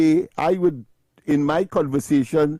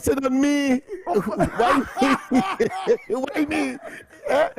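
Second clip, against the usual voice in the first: higher, laughing through the words; laughing protest; 7 semitones higher than usual. Real, not acted. Host laughing as the caller picks on him. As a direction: laugh off the accusation.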